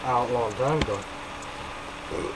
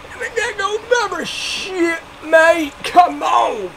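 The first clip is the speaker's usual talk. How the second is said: much higher squeaky cartoon voice; squeaky hype voice; 14 semitones higher than usual. Taunting the taco chains, squeaky hype shout.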